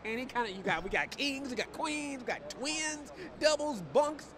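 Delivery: high-pitched, excited sales patter; hammed-up huckster listing the wares; bed salesman pitch. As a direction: reel off the stock in a rising singsong. Playing a bed seller's pitch.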